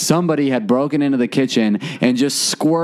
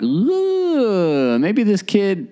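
high, slow worried ooh; half-joking fear of the worst; mock dread; 4 semitones higher, much slower than usual. Friends joking the missing kid drowned, mock dread. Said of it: "we're like".

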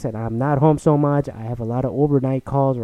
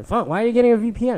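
higher, fast, outraged questioning; mock indignant parent; 10 semitones higher, 4 dB louder, faster than usual. Parent snapping at the child about a VPN, fast and high.

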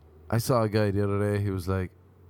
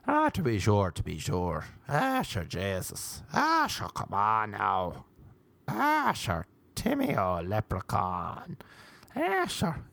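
mock Irish accent; 4 dB quieter, slower than usual. Mocking people who do the same accents to him, hammed-up Irish. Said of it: "people do the same accents to me".

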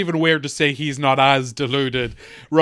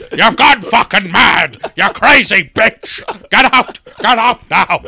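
furious father; 3 semitones higher, 6 dB louder than usual. Retold or imitated. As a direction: bellow it like an enraged patriarch. Father throws wife out.

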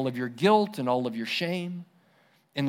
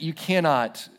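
ordinary talk, nothing marked

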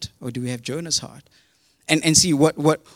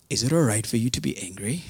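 low, calm, unruffled question; chilled out; 4 semitones lower, faster than usual. Retold or imitated. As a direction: ask gently, with no heat at all. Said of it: "so chilled out".

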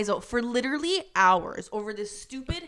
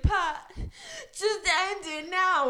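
mock sobbing plea; 9 semitones higher, slower than usual. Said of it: "She was like".

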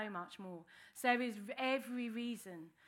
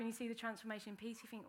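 ordinary talk, nothing marked

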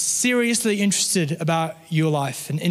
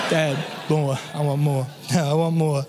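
lightly imitated little boy, chuckling between pleas; toddler son; 3 semitones lower than usual. Son nagging dad for more chocolate, lightly imitated toddler. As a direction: repeat the demand in short baby-talk bursts. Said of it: "that's how he sounds".

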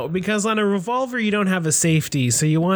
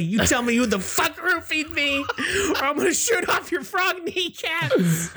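high, laughing tough-guy threat; mock threat; 10 semitones higher, slower than usual. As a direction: play the tough guy, cracking up. Player threatens frog at gunpoint.

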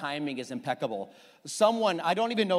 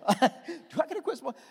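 higher, laughing through the words; laughing retelling; 8 semitones higher than usual. Retold or imitated. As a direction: repeat the question through a laugh. Retelling the question while laughing.

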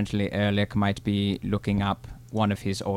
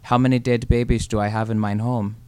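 deadpan German joke; flat German punchline; German accent. Acted. Hans tells dark joke.

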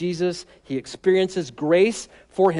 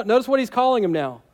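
ordinary talk, nothing marked

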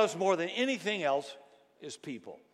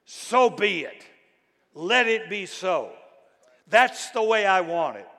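loud, emphatic amen on steroids; jacked-up affirmation; 3 semitones higher, 7 dB louder than usual. Shown as a demonstration. Preacher translating amen for today's congregation, jacked-up and emphatic. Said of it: "Amen was yes on steroids".

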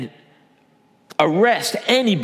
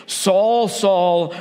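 heavenly voice calling; 4 semitones higher than usual. Acted. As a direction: call Saul from heaven so he hears.

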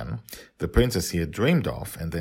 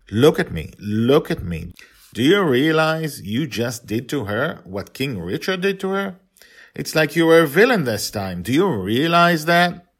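raised voice, higher and louder, scolding; father scolding his daughter for locking up her friend; 8 semitones higher, 7 dB louder than usual. A narrator's reading. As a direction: tell her off sharply as she looks away.

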